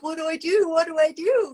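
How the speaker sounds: high, loud, frantic repeated plea; panicked. Retold or imitated. Woman calling her friend in a panic. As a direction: beg for help in a panic.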